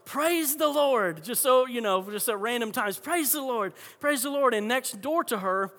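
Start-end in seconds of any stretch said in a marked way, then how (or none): 0.0-1.2 high, drawn-out porch holler; retold holler. Imitating the elderly lady on her front porch, hollering. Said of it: "she would holler". 3.0-4.5 higher-pitched mock holler; retold holler. The elderly lady at random times, mock hollering. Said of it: "she would holler".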